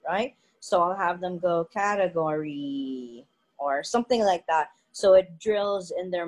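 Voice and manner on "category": "category" with its stressed syllable stretched out, CAAA-tegory; exaggerated teaching stretch on "category"; elongated stress. Shown as a demonstration. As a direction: drag out the stressed part of "category". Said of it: "have them elongate the part that is stressed on purpose".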